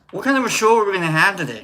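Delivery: ordinary delivery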